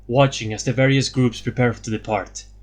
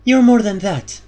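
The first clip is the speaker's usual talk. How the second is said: Elara's voice, higher and louder; Elara, firm; 9 semitones higher, 7 dB louder than usual. A narrator's reading. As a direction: answer as the firm leader Elara.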